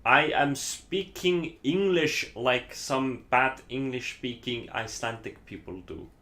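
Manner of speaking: slow, deliberate bad Icelandic-accented English; fake Icelandic accent. Shown as a demonstration. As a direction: imitate a heavily accented Icelandic speaker of English. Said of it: "doing the fake Icelandic accent".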